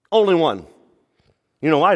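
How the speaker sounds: ordinary talk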